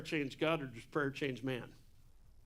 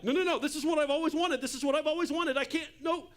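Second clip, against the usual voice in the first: higher, louder, fast pleading no's; mock panicked refusal, rushing the words; retold frantic plea, higher and louder; frantic protest; 9 semitones higher, 4 dB louder, faster than usual. Retelling his resistance to God.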